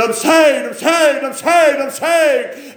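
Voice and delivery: high, loud jubilant cries; James. Retold or imitated. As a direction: imitate a new convert shouting it over and over. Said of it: "he said, he said".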